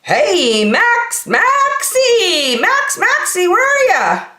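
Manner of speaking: high, loud calls across the house; singsong calling voice. Acted. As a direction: kid hunting for Max.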